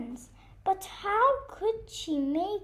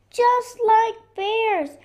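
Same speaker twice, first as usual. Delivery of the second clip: loud, high, stretched holler; excited shout; 7 semitones higher, 9 dB louder, slower than usual. Acted. Friends pretending to be bears, playful shouting. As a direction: shout it high, drawing out each word. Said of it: "they shouted".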